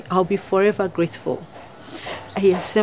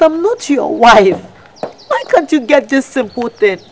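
angry insistence; 3 semitones higher, 4 dB louder than usual. Acted. Married woman angrily insisting to the man who calls her wife. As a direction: hammer it home, fed up. Shouting down the stalker.

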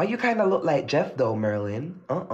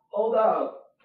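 normal talking voice

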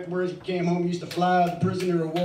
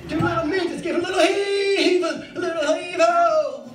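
mock heave-ho strain; 8 semitones higher, 5 dB louder than usual. Comic heave-ho chant.

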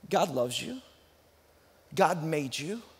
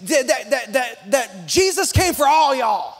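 Texan accent leaning on all y'all; Texas y'all; 3 dB louder than usual. Shown as a demonstration. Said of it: "we'd say it like this".

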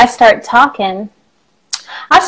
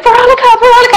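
high, loud fans calling out a star's name; high-pitched mock cheering crowd; adoring crowd; 11 semitones higher, 6 dB louder, much faster than usual. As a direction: imitate excited fans shouting for their idol. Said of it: "they're like".

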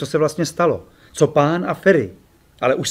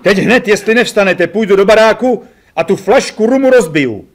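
loud, high mock threat; threatening; 6 semitones higher, 5 dB louder than usual. A narrator's reading. Narrator threatening to smash the rum bottle, mock menacing. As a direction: threaten them half-laughing. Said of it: "řekl jsem hrozivě".

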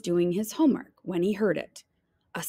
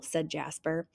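ordinary delivery

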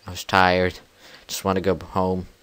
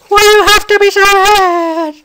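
high whiny complaint; 15 dB louder than usual. Real, not acted. Mob attacking.

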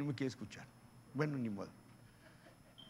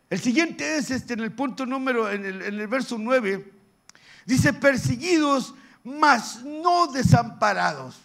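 loud, high declamation; ringing pulpit reading; forceful scripture preaching; 7 semitones higher, 10 dB louder than usual. Acted. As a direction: proclaim the verse slowly and powerfully.

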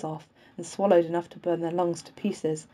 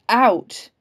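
loud, high yell of command; forceful one-word bellow; shout; 4 semitones higher, 9 dB louder than usual. A narrator's reading. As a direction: shout him out of the dugout. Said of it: "I shout again".

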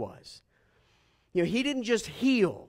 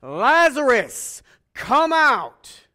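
slow, loud commanding call; 4 semitones higher, 10 dB louder, much slower than usual. A narrator's reading. Jesus calling Lazarus from the tomb, slow loud command. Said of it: "called out in a loud voice".

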